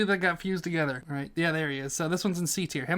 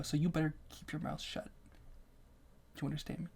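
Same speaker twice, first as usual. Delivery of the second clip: hushed mock threat, sinking to a whisper; mock menacing whisper; 13 dB quieter than usual. Host warning viewers who heard the accent, mock-threatening whisper. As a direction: drop to a whisper as if warning them.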